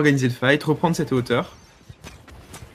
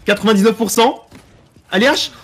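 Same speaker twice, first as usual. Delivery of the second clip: excited; 7 semitones higher, 6 dB louder than usual. Real, not acted. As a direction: urge the player on, building. Cheering a capture at 99 percent.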